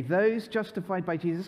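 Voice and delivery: ordinary talk, nothing marked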